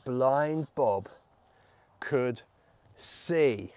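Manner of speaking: halting, low delivery with pauses between words; suspenseful reveal. Acted. Storyteller reveals the healing to children, halting and low. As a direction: hold back each word for suspense.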